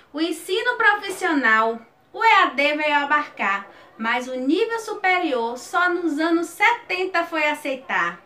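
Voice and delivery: lifted, rhythmic folk-poem recitation; cordel recital. Shown as a demonstration. Teacher reciting her own cordel about remote learning, rhythmic and lifted. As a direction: recite like a cordel poet on stage.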